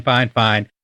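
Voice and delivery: ordinary delivery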